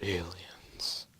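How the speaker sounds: a soft, breathy whispered word; awed whisper. Acted. The whole world whispering in awe at the first sight of aliens. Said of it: "Came the mutter in a hundred languages".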